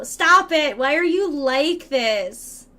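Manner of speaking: loud, drawn-out exasperation; mock scolding. Streamer telling off a chatty viewer, in mock exasperation. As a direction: plead loudly, stretching the words out.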